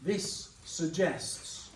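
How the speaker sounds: very slow, drawn-out sentence starter; slow teacherly prompt. Shown as a demonstration. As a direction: stretch it for the class to finish. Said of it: "carry that sentence on".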